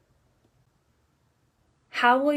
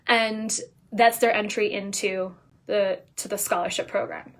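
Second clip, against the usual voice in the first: ordinary delivery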